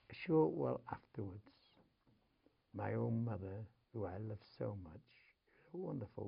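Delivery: ordinary talk, nothing marked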